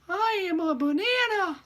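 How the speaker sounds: very high squeaky cartoon squeak; cartoon banana voice. Teacher voicing a banana in a squeaky cartoon voice. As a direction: play a silly cartoon banana. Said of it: "I'll just do it one more time".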